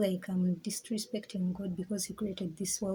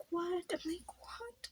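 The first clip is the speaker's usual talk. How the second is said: soaring higher, fading to breath; stunned what; 9 semitones higher, 9 dB quieter, slower than usual. Retold or imitated. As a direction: ask it in airy disbelief.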